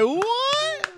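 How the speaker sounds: squeaky mock surprise; high startled what. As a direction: yelp a confused what. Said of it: "I was like".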